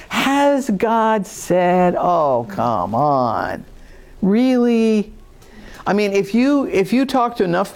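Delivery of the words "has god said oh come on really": slow, mocking disbelief; condescending whine. A skeptic doubting your faith, slow and mocking. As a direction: sneer it out like a skeptic. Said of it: "that whiny little condescending tone of voice".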